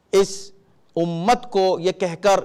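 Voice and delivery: ordinary talk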